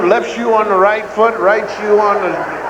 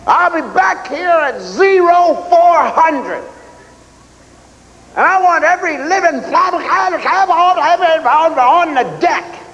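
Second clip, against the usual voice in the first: drill sergeant bellow; 9 semitones higher than usual. Retold or imitated. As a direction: boom it out like a drill sergeant, slowly. Drill sergeant voiced in story.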